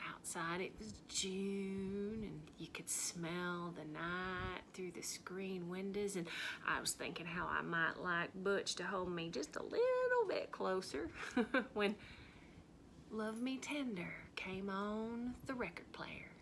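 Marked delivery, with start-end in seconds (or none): 0.1-6.1 slow, hushed romantic whisper; dreamy whisper. Acted. Recalling the June night with Butch, slow dreamy whisper. 13.1-16.3 dreamy whisper. Acted. Romantic memory, hushed.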